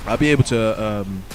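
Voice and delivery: normal talking voice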